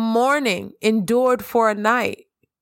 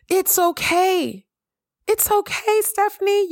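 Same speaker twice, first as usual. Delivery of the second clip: reassuring; 11 semitones higher than usual. Real, not acted. Comforting herself by name.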